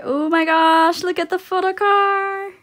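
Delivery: high, loud thrilled exclaim; excited. Real, not acted. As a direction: squeal over the find. Photo card find.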